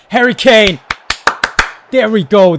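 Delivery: loud, high triumphant cry; real joyful shout; goal celebration. Real, not acted. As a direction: cheer the goal. Goal is scored.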